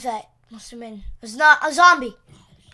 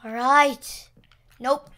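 ordinary delivery

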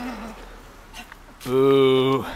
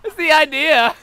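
laughing. Real, not acted. Laughing through the game.

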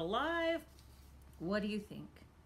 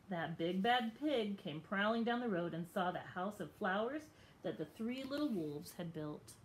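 usual speaking voice

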